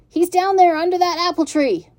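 a lightly voiced farmhand shouting; farmhand calling. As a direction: farmhand pointing out the pig. A man points out the pig.

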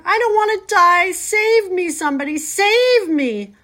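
high, loud, wailing plea for rescue; terrified screaming. A narrator's reading. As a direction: scream for your life. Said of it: "screamed Wilbur".

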